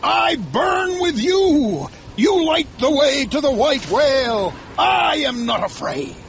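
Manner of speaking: high, fervent captain's shout; defiant cry into the storm; drawn-out, exalted raving. A narrator's reading. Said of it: "shouted Ahab".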